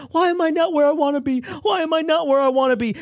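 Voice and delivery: lightly mocking someone stuck in life; whiny self-pity. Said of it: "we think".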